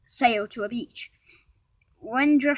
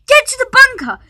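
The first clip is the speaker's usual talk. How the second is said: high, loud battle yell; shouted order; 14 semitones higher, 17 dB louder than usual. A narrator's reading. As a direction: bellow it across the battlefield. Someone yelling an order.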